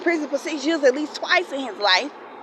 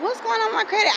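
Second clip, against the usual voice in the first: high, startled question; pitched way up in alarm; alarmed surprise; 10 semitones higher, faster than usual. Retold or imitated. Checking her credit and finding damage, high alarm.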